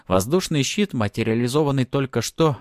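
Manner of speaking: plain reading voice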